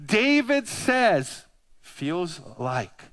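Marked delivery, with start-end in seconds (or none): 1.8-3.0 softer, slow, hushed quotation; soft, reverent reading. A narrator's reading. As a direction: savour the psalm gently. Quoting David's psalm.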